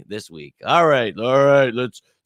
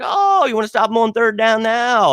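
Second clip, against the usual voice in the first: sarcastic jab; 8 semitones higher, 3 dB louder than usual. Retold or imitated. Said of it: "and I was like".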